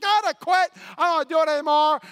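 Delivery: high, loud quit; pastor's mock Monday outburst at God; exasperated outburst. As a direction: blurt it out, fed up. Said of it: "We're like".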